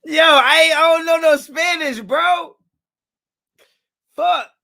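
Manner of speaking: very high, loud comic wail; exasperated wail. Real, not acted. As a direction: cry out helplessly in embarrassment. Embarrassed after botched Spanish reading.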